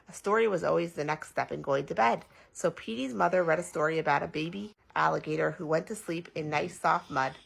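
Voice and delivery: plain reading voice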